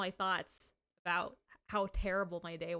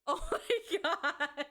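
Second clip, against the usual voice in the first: high, laughing through the words; cracking up, almost in tears; 8 semitones higher than usual. Real, not acted. Streamer laughing hard at a chat prank. Gifted subs, word ban joke.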